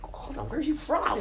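high-pitched mock astonishment; shocked. Casting people startled by the actor's switched accent, shocked. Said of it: "they get a bit shocked".

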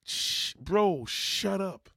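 loud, high shh then shut up; angry shushing at nephew; shushing shout. Retold or imitated. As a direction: cut him off sharply.

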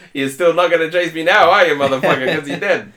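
hammed-up triumphant taunt; mock taunt. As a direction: crow over a beaten pursuer, playfully. Acted taunt to the shot man.

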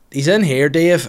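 called out over the fence; neighbour shouting over. Retold or imitated. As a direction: shout it across the gardens.

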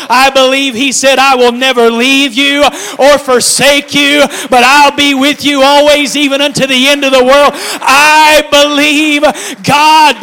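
high, loud, slow preacher's shouting; full-force preaching shout. Acted. Preacher leading the church in a shouted declaration. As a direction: shout it out in a whooping climax. Whooping climax of the sermon.

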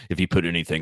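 usual speaking voice

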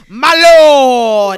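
loud drawn-out courtly bow; old English courtly. Shown as a demonstration. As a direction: say it like a royal herald. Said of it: "You gotta say the lo".

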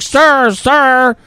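man on a bench calling a passerby, high and loud; calling out sir. Retold or imitated. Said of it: "some guy on a bench is like".

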